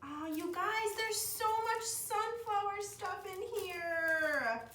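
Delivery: high, slow delighted squeal over the gift. Real, not acted. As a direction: draw it out way up high in pure delight. Unboxing sunflower gift, delighted.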